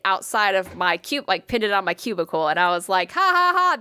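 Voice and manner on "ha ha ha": high, spoken-out ha-ha-ha; fake polite laugh. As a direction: force a laugh syllable by syllable.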